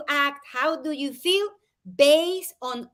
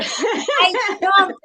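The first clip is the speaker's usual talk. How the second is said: said through laughter, slow; laughing; 7 semitones higher, 6 dB louder than usual. Real, not acted. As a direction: laugh as you admit it. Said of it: "We both answer".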